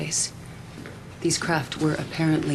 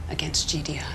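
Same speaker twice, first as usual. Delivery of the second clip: soft, breathy whisper of resolve; hushed vow; 2 semitones lower, 8 dB quieter than usual. Acted. Leader whispering a promise of victory to her children. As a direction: whispers the last words like a secret oath.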